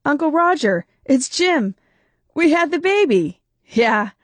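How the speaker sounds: put-on new father calling his uncle about the baby, excited; excited announcement. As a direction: burst out the happy news. Jim phoning with birth news.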